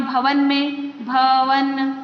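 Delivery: भवन said slowly, syllable by syllable; teacher sounding out भवन; slow demo. Shown as a demonstration. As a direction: break भवन apart slowly as भ-व-न. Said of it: "तो आप इसे बोलकर देखिए".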